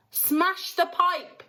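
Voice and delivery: high, slow demand to smash it; mother yelling at the crowd to smash the pipe; yelled order. A narrator's reading. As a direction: yell an order. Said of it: "yelled Missus Gloop".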